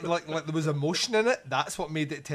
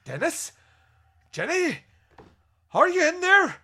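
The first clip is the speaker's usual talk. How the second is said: high, loud Yorkshire call into the house; 9 dB louder than usual. Retold or imitated. Man calling for Dennis and Jenny, high Yorkshire call. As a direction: shout the names in a put-on Yorkshire accent. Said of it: "that's when a wee bit Yorkshire".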